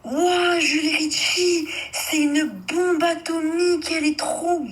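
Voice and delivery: high, slow, awestruck gushing over her; gushing boyfriend. Retold or imitated. Friend mimics her boyfriend raving about a star, gushing. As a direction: ham up his dazzled admiration. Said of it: "Il me dit".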